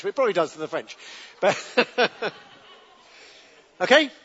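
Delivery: ordinary talk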